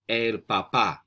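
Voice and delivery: "El papá" measured and distinct, for repeating; model pronunciation. Shown as a demonstration. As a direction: model "El papá" for learners, stressing the last syllable. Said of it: "I'm gonna say the word three times".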